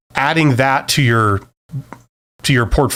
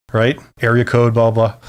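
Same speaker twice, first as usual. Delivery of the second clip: ordinary talk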